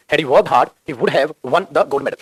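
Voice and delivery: "would" stressed, each word drawn out very slowly; unhurried dictating voice; slow dictation. Shown as a demonstration. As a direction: read it out slowly for copying. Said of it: "stress करके बोलना".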